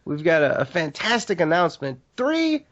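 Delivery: ordinary delivery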